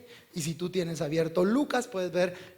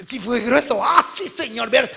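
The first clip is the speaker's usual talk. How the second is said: higher, lightly acted eager report; excited disciples; 6 semitones higher, 7 dB louder than usual. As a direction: play disciples telling their master. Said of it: "El Señor los ve emocionados". (discípulos algo emocionados)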